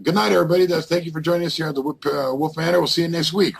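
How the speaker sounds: high, bright host farewell voice; cheery show sign-off. Retold or imitated. Ghost hunter mimicking his own show sign-off, high and bouncy. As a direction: play the host closing the broadcast.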